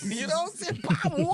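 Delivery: high, slow drawn-out denial; slow plea. Retold or imitated. Son repeats his innocence to mother, in a high slow voice.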